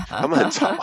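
laughing high-pitched. Real, not acted. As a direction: say it through a burst of laughter. Laughs at own joke.

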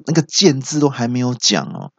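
normal talking voice

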